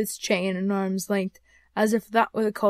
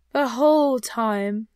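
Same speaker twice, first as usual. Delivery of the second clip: ghostly answer; 2 semitones higher, 6 dB louder, slower than usual. Acted. Voices the ghost in the story.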